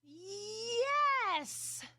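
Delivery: loud high screamed yes. Acted. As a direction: scream it out joyfully. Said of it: "the girl screamed".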